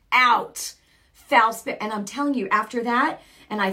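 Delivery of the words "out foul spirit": commanding. Retold or imitated. Commanding spirit out.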